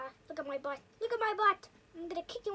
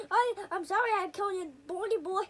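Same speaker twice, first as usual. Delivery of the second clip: ordinary talk, nothing marked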